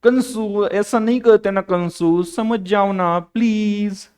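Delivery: slow, high, sweet coaxing; pleading tenderly with a stubborn concept; loving coax. Shown as a demonstration. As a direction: coax it like sweet-talking a baby. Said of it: "उसे प्यार से बोलने का".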